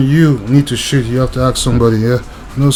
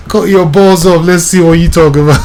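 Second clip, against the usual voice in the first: laughing; 4 semitones higher, 4 dB louder than usual. Real, not acted. Mocking dog owners, laughing.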